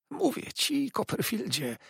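high sly hiss of a servile clerk; hissed in the ear. A narrator's reading. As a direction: hiss it right into his ear. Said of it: "syknął mi nad uchem". (syk nad uchem)

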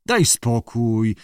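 ordinary delivery

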